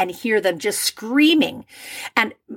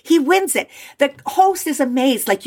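ordinary delivery

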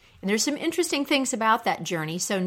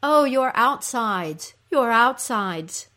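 louder, raised, drawn-out lament; echoed cry; 4 semitones higher, 6 dB louder, slower than usual. Acted. Creatures crying out along with the queen, echoed cry. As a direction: wail along in sympathy, slowly. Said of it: "creatures also cry out".

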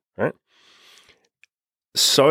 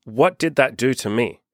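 ordinary talk

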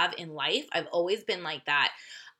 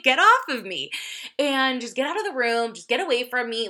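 higher, louder exasperated outburst; retold losing it; 5 semitones higher, 5 dB louder than usual. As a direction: act out snapping at someone to back off. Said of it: "I just kind of lost it".

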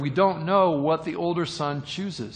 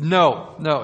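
loud, firm no, no; a father loudly cutting his son off; emphatic father's no; 8 dB louder than usual. Retold or imitated. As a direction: wave the plan away with a loud no. Father refusing son's plan.

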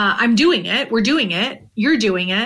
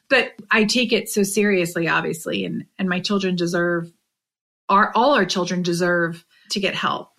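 ordinary delivery

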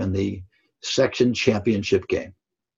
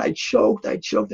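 imitating crying boy; 10 semitones higher, slower than usual. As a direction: echo the player's despair, lightly. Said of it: "he was crying".

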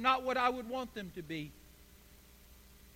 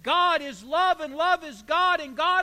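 higher and louder, ringing out; preaching climax; 6 semitones higher, 7 dB louder than usual. Real, not acted. Pastor closing the point to his congregation, loud and high. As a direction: build to a full preaching peak.

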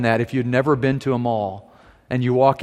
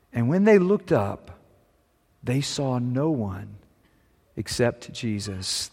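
scripture reading; 2 semitones higher than usual. Acted. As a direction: read the passage as holy word. Reads the Gospel passage aloud.

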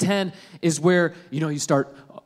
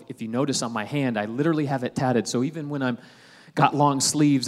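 usual speaking voice

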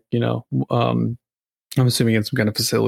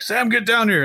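calling out; 10 semitones higher than usual. Retold or imitated. Dad calling son downstairs.

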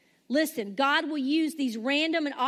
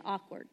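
awkward set apart, softer and lower; repeated key word; 6 semitones lower, 9 dB quieter than usual. Shown as a demonstration. Pastor repeating awkward to the congregation. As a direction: drop the word awkward low and quiet. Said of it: "Here's that word again".

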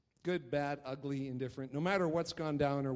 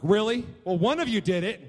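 stern dad; 7 semitones higher, 9 dB louder than usual. Retold or imitated. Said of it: "I'm like".

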